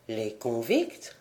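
low, soft, slow puzzled question; uncomprehending. A narrator's reading. Old sailor who understands nothing of the question, baffled. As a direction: echo it slowly, rising in bewilderment. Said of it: "du ton d'un homme qui ne comprend rien".

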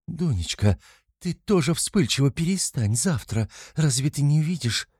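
mildly frightened, high-pitched pleading; a touch of motherly panic; panicked mother. A narrator's reading. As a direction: hush a daughter in alarm. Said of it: "перепугалась мать".

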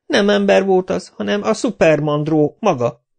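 high, whining plea to the boss. A narrator's reading. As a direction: whimper it up high like a scared lackey. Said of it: "siránkozott Kapa".

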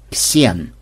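low, cool acknowledgement; cold. A narrator's reading. Dwarf coolly greets the programmer by name, cold. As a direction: say the name icily. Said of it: "холодно заметил".

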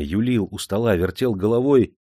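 usual speaking voice

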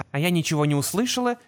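ordinary delivery, nothing marked

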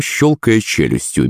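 plain reading voice

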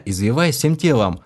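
plain reading voice

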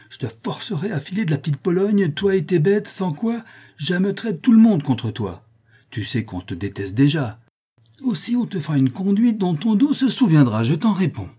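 high, emphatic warning; indignant, forceful threatening; angry threat. A narrator's reading. Elder threatens trainer.

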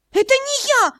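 high, loud panicked cry; shout. A narrator's reading. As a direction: blurt it out in alarm. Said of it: "Крик сорвался".

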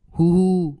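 a high wailing sob, bursting into tears; loud sob. A narrator's reading. Suspect bursts into loud sobs over family photos, wailing. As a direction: break down into a loud wail. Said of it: "òa khóc thành tiếng".

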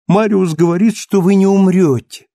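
high, loud, tearful insistence. A narrator's reading. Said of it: "сказала Казетта вся в слезах".

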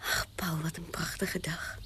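soft unvoiced reverie; dreamy whisper. (dromerig fluisterend)

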